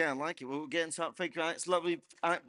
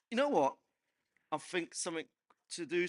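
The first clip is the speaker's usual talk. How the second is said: ordinary talk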